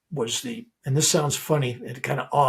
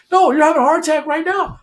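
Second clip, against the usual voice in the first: high, loud urgent insistence; panicked nurse; 14 semitones higher, 8 dB louder than usual. Retold or imitated.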